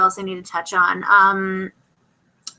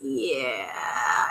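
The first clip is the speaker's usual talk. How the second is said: much lower, softer, gruff one-word grunt; low grumbled Killian; 9 semitones lower, 10 dB quieter than usual. Retold or imitated. Recapping Killian answering Charming, a low grumbled yeah. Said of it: "And then Killian's like".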